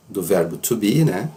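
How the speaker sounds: ordinary delivery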